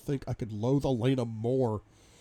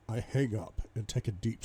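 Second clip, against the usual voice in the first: plain reading voice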